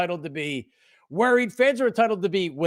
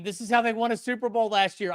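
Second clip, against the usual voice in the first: ordinary talk, nothing marked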